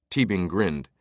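ordinary delivery

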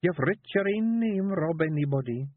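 high Scottish Feegle woman coaxing; wife coaxing her husband into writing. A narrator's reading. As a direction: push your husband gently, high and Scots. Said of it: "said Jeanie".

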